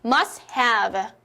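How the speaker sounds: "must have" as "mas hav", silent T; T-dropped drill. Shown as a demonstration. Teacher leads two repetitions aloud, T dropped. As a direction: model "must have" in two clear beats without T. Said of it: "소리 크게 내어볼게요".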